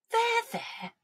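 soft, very high cooing; soothing queen. A narrator's reading. Queen comforts the sobbing king, soothing. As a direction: coo it to a tearful king.

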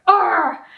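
loud, high frustrated Arr; angry growl. Shown as a demonstration. Teacher imitates an angry outburst. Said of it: "you're angry".

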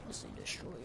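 unvoiced breathy Pussy Destroyer; scary hushed voice; scary whisper. Shown as a demonstration. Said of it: "gotta say it scary like that".

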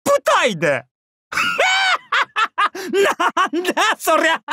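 high-pitched, helpless laughter through the words. Acted. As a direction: crack up while teasing, voice leaping high. Cracks up at lord's swimsuit look. (甲高く笑い転げながらからかう)

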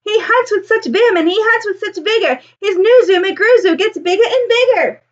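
high, excited crowd voice with a laugh; admiring townsfolk. Acted. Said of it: "they will say".

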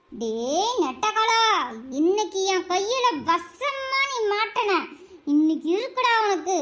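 low, slow menacing vow; slow low threat. Acted. Wife muttering revenge on her husband, slow and low. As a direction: growl out the threat slowly.

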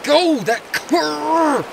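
high, loud urging yell; excited shout. Real, not acted. Urging his fishing buddy's cast, a high excited yell. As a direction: yell him on as the fly lands.

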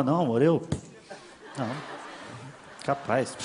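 low, hushed, halting excuse; mock evasive denial. Boyfriend answering an accusing girlfriend, mock sheepish and hushed. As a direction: deny it quietly, with pauses between words.

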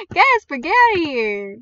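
loud, high-pitched shooing; exasperated shout. Real, not acted. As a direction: shout the pest away. Shooing away the unwanted Gasper.

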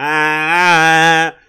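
loud taunting ah at the crowd; mocking jeer. Jeering crowd with middle fingers.

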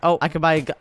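usual speaking voice

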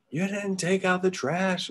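high, drawn-out mock mom voice; scolding mom. Imagined mom interrupts a Zoom call.

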